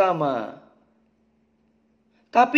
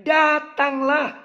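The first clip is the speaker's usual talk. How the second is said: "Datanglah" pitched higher and drawn out slowly; slow raised emphasis; 8 semitones higher, much slower than usual. Shown as a demonstration. Preacher driving home Jesus's call, slow and high. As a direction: lift "Datanglah" up and stretch it. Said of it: "Maka penekannya".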